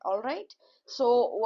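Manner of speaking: usual speaking voice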